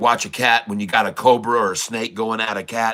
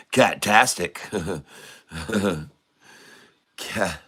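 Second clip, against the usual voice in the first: ordinary delivery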